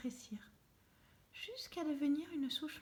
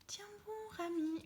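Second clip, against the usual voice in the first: high call to her brother; shouting; 8 semitones higher than usual. A narrator's reading. Lara calling up to Rami in the tree, a high call. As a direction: cry out high to encourage him. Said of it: "hurle Lara".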